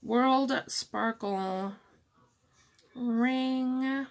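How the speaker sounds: normal talking voice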